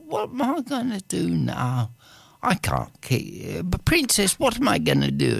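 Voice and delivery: high fretful young gardener, lightly voiced; worried gardener.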